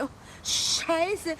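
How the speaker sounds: normal talking voice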